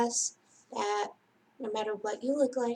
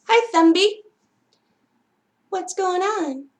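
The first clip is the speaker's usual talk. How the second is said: cheery greeting; 8 semitones higher, 9 dB louder than usual. Acted. Greeting puppet friend.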